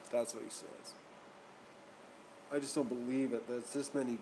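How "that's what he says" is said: softer, breathy doubtful aside; skeptical under-breath repeat. Real, not acted. Vlogger doubting the support guy, muttered aside. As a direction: mutter it again to yourself, unconvinced.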